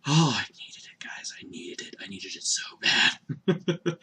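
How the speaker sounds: soft breathy confession; slow, laughing whisper. Real, not acted. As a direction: whisper it like a guilty secret. Confessing his craving to viewers.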